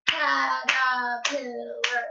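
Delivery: CATERPILLAR very slow, CAT-ER-PIL-LAR; slowly sounded-out Caterpillar in four parts; syllable by syllable. Shown as a demonstration. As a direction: stretch Caterpillar into four held beats. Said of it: "Four syllables".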